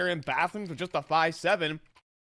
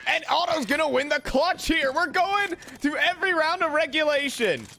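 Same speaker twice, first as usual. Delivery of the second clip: high, thrilled esports call; full-force play-by-play; 9 semitones higher than usual. Real, not acted. Caster calling the one versus one clutch, full-force play-by-play. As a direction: drive the call up to a shouted climax.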